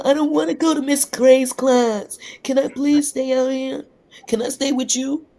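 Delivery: put-on high, loud childish whine; pleading schoolgirl. Pupil begging the dean to skip class, high pleading voice. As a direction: plead like a kid dodging class.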